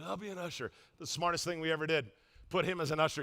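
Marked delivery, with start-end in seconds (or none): 0.0-0.7 Glenn's flat reply. Retold or imitated. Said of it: "He goes".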